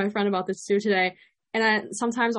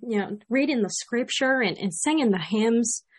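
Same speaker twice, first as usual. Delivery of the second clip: country accent; 3 dB louder than usual. Shown as a demonstration. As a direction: demonstrate a folksy Southern country accent. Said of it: "add some country to it".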